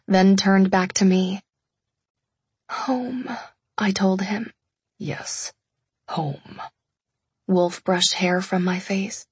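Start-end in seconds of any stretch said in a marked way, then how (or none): 2.7-3.3 P=A; soft weak. A narrator's reading. Injured wife insisting softly. 5.0-6.6 very soft, low reassurance; quiet low agreement. A narrator's reading. Wolf agreeing gently.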